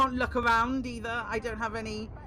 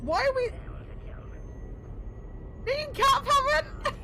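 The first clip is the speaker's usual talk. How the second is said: squeaky high voice; 14 semitones higher than usual. Real, not acted.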